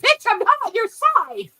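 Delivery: high, loud mock retort; mocking PewDiePie. Imitating PewDiePie answering the vegan teacher: high, loud mock retort. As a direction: fire it back like a gleeful comeback. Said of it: "He's like".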